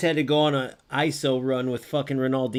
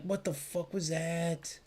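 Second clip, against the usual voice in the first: stunned disbelief; 4 semitones higher, 8 dB quieter, slower than usual. Real, not acted. Streamer stunned by a game play.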